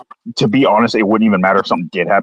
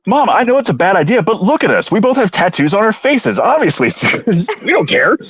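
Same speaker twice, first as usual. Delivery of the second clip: higher, hammed-up sales pitch; mock pleading to mom; 10 semitones higher than usual. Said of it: "It's like".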